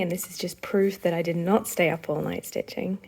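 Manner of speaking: ordinary delivery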